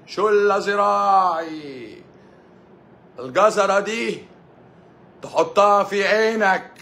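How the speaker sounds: stretched, high mock delivery; mock drawn-out sing-song. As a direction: drag every word out high like a teasing chant.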